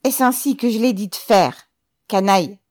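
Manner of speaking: high, loud scolding; loud, high-pitched outrage; angry squawk. A narrator's reading. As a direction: squawk it at your underling. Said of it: "piailla le commissaire".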